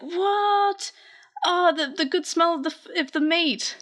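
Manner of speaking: high, put-on voice, dazed by the smell; dreamy hungry character. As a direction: swoon over the meat in a squeaky voice. Drooling Espimon voiced in recap.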